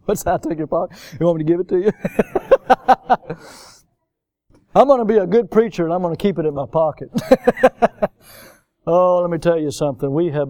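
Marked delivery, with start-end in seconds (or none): 2.0-3.3 higher-pitched burst of laughter; amused laugh. Real, not acted. Laughs at own teasing joke. 7.1-8.1 short, high run of chuckles; amused laugh. Real, not acted. Laughs after joke about pocket.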